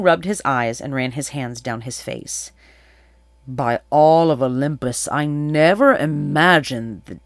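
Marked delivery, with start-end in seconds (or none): 3.5-7.2 astonished king. A narrator's reading. King reacts to learning the story is true, loud astonishment. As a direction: swear by the gods in stunned wonder.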